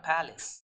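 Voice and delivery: Palace with a short "is" ending; Palace, weak short vowel in the last syllable; correct pronunciation demo. Shown as a demonstration. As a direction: say Palace as PAL-is. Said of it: "the pronunciation is what?".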